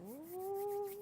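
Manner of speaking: high, soft drawn-out "ooh"; quiet coo of admiration; soft admiring ooh. Real, not acted. Admiring the necklace on show.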